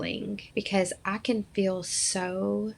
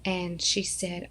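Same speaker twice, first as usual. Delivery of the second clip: usual speaking voice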